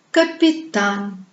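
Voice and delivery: "Capitaine" slowly, with the final A drawn out; exaggerated long final A in "Capitaine"; stress on last A. Shown as a demonstration. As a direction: lean on the last syllable of "Capitaine". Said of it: "L'accent tombe sur la dernière A".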